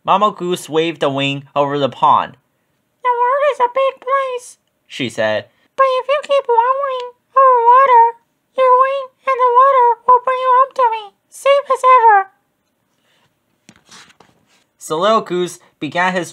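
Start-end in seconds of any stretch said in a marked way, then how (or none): 3.0-4.6 very high, quick voice of the mother goose; a light, high motherly goose. A narrator's reading. Mother goose gently answers her little son, high and quick. 5.8-12.3 very high, louder mother goose promising safety; a high, firm motherly goose promise. A narrator's reading. Mother goose reassures her son before his trip, high and louder.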